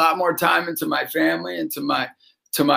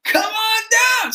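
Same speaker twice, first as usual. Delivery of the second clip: game-show call; 14 semitones higher than usual. Retold or imitated. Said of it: "So I'm like".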